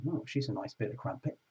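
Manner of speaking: low, leering pub bloke voice, lightly done; a hint of a leering British lad; lecherous British bloke. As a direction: play a drunk bloke eyeing a girl in a bar. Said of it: "they would say".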